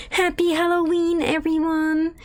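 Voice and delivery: high, sing-song fake cheer mocking the devs; mock cheery company. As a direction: chirp it like an overeager brand announcement. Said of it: "They're like".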